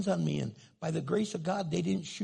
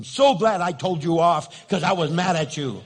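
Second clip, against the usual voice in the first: much louder, mock-angry outburst; defiant blurt; 10 dB louder than usual. Husband telling off his wife instead of apologizing, loud defiant blurt.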